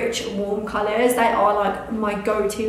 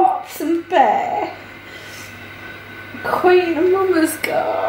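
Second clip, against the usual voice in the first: high, drawn-out, slow tearful reading; each name stretched through happy tears; tearful, moved; 7 semitones higher, slower than usual. Real, not acted. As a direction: read the names while overcome. Mum crying reading out names.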